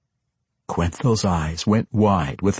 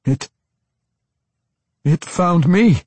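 high, louder, halting panic; frightened stammer; 11 semitones higher, 5 dB louder than usual. A narrator's reading. Tosin fears a horrible death, stammering. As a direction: stumble over the first word, pitch jumping up in fear. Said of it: "Tosin stammered".

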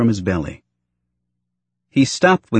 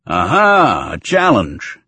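roaring; 2 semitones higher, 5 dB louder, slower than usual. A narrator's reading. Father delighted by the girl's challenge, roaring. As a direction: boom it out with mock relish. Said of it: "Klieg roared".

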